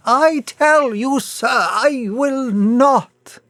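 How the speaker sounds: high, slow rising refusal. A narrator's reading. Mister Bunting refuses the stranger, voice rising. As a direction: climb in pitch as he refuses. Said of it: "his voice rising".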